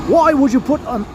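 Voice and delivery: high-pitched mock British chef outrage; put-on angry British chef voice; angry Gordon Ramsay. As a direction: start berating a cook, hammed-up. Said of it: "an angry Gordon Ramsay voice".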